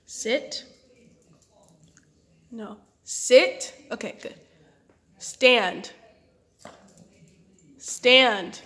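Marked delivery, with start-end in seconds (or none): none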